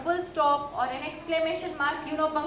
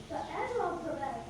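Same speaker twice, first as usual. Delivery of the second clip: quiet class chorus; 10 dB quieter, slower than usual. Real, not acted. Class echoes teacher's line.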